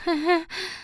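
high, forced little giggle. Acted. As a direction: giggle nervously, not meaning it. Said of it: "Twilight kicherte gezwungen". (gezwungenes Kichern)

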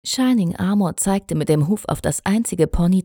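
ordinary delivery

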